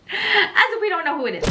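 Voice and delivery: high, laughing disbelief; giggling as if the answer is obvious. Real, not acted. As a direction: laugh through the line, knowingly. Laughing at obvious love interest.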